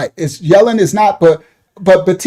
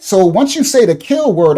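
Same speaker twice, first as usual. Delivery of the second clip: usual speaking voice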